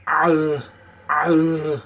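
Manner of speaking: loud grunting "Ah, ah" into Austrian "thirty-three inches"; Arnie grunt and accent. Shown as a demonstration. Demonstrating Arnie's chest rumble, loud grunting. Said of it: "It's way down here".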